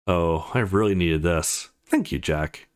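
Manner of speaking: low, slow pleasured murmur; relaxed, grateful low voice; contented relief. Acted. Character enjoying a massage.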